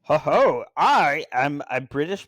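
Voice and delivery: loud, hammed-up fake British with New York in it; bad British accent. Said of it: "like, a New York accent".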